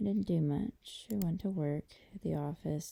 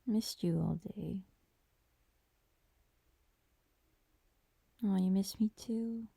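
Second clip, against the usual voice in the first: whispering; 4 semitones higher than usual. Acted. Tender whisper during a hug.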